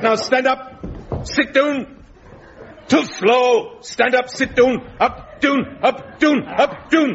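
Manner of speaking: high, sharp parade-ground commands; barked drill orders. Acted. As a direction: snap out the orders. Drilling them up and down.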